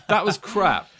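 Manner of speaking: said through a laugh; laughing put-down. Real, not acted. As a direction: laugh while repeating the put-down. Repeats verdict while laughing.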